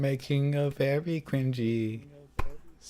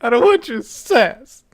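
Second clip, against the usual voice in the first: high mock voice; 14 semitones higher, 12 dB louder, slower than usual. Echoes the mock back.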